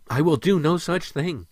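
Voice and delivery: high, firm refusal; adamant. A narrator's reading. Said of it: "Samar stated adamantly".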